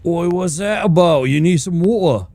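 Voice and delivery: high, loud mock Cockney; East End accent. Streamer voicing an in-game Cockney character, loud mock East End accent. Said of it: "East Ender with a rough, rough accent".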